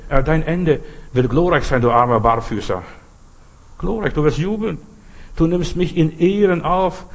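preaching. Acted. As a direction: declaim the promise to the congregation. Preacher declaims psalm promises.

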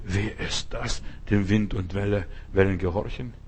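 disciples' awed, hushed question. Retold or imitated. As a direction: wonder aloud, almost in a whisper. Disciples awed after the storm.

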